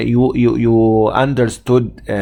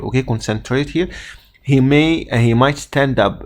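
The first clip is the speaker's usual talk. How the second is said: ordinary talk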